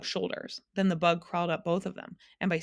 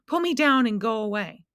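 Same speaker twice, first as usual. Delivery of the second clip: pleading; 5 semitones higher, 5 dB louder than usual. A narrator's reading. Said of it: "he pleaded".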